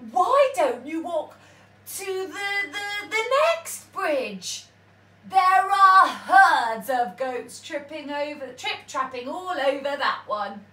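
a rabbit pointing the troll onward; crafty rabbit. A narrator's reading. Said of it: "said the rabbit".

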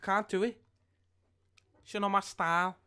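exaggerated Scouse footballer voice; Scouse accent. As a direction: put on a Scouse accent like Jamie Carragher. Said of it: "Do a Scouse accent".

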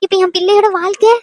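high-pitched motherly lament; worried mother in a high voice; distressed mother. Acted.

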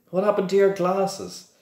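put-on high, light girl's voice. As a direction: voice a schoolgirl.

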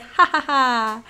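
slow, loud fake ha-ha-ha; mock a gloating laugh, spaced out; imitated mocking laugh. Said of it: "She was just laughing at me".

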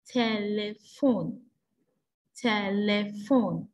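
slow, measured word list for counting syllables; teacher's deliberate dictation, each word broken into beats; syllable-by-syllable clapping. Shown as a demonstration. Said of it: "Remember to clap each word".

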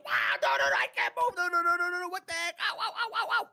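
high frantic no's and ow's; rising panicked ouch; panicked cries. Real, not acted. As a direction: caught and helpless, yelping. Player stunned and attacked again.

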